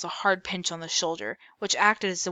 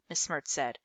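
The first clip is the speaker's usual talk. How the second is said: ordinary delivery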